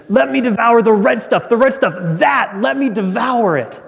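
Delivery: high, frantic acted demand; raving with hunger. Starving Esau demanding red stuff, raving. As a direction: blurt it urgently, desperate for food. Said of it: "a man who is almost raving".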